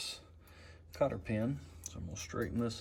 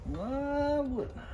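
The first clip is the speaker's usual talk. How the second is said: high stretched mock whine; exaggerated sing-song whine; much slower than usual. Real, not acted. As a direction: drag it out, high and whiny. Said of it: "Of course I did".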